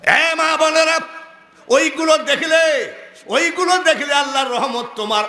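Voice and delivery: high, urgent preacher's call; exhorting cry. Real, not acted. Preacher warns mothers and sisters off such shows, calling high.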